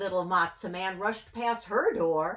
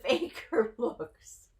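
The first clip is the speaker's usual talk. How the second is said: ordinary delivery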